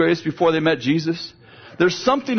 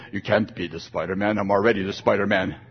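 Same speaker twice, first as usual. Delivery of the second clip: put-on low, commanding little-boy voice, lower and softer; authoritative boy; 8 semitones lower, 5 dB quieter than usual. Grandson bossing his sister, in a low authoritative voice. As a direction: grandson laying down the law to his cousin, mock authoritative. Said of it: "in his most authoritative voice".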